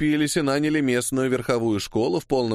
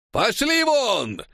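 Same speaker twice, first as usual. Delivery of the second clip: high, drawn-out commander's shout; barked order; 11 semitones higher, much slower than usual. A narrator's reading. Cossack commander driving off strikers, barking. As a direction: roar them away. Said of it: "гаркнул командир казаков".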